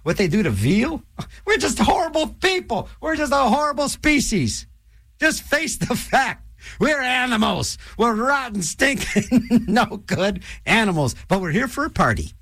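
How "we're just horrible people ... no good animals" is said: higher, mock-preachy rant on humankind. DJ hamming up a rant that humans are rotten. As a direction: ham up the self-loathing sermon.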